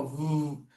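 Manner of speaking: "vu" as a sustained vvv drone; low, held "vu" buzz; airplane sound. Shown as a demonstration. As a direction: hum a long buzzing V like a plane. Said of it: "que é o som do avião".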